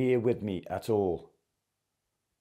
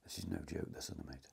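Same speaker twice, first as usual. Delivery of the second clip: hushed; 6 semitones lower, 16 dB quieter than usual. Real, not acted. Spooked aside to mate.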